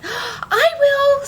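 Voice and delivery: very high, loud and eager; a dog eager to eat the bread; eager animal. A narrator's reading. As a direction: squeal it out greedily.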